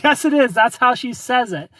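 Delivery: high, loud mock insistence; retold arguing. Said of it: "arguing with my ex-girlfriend".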